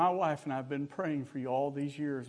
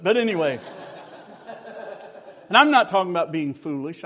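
laughing; 2 semitones higher, 7 dB louder than usual. Real, not acted. Laughs at own story.

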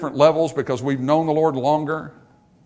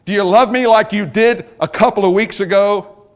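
raised, higher questioning voice; pressing challenge; 6 semitones higher, 8 dB louder than usual. Retold or imitated. Preacher voices the Lord questioning Peter.

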